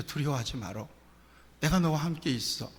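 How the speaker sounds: gentle quiet voice of comfort; soft reassurance. Acted. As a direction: speak it tenderly as God. God's words from Isaiah quoted.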